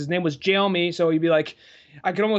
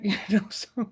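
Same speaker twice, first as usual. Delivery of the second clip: usual speaking voice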